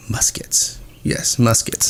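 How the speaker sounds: unvoiced whisper, a mock-serious guess; hushed tongue-in-cheek whisper; whispered joke. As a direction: breathe the words out in a whisper. Joking guess at the gunfire.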